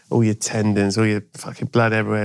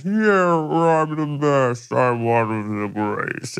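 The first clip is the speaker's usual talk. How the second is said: high boast that slows and sinks; winding down like a machine; 3 semitones higher, much slower than usual. Retold or imitated. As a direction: run out of power mid-brag. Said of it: "like a sort of machinery slowing down".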